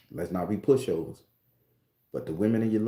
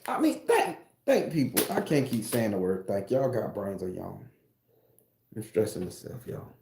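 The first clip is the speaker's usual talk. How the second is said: ordinary talk, nothing marked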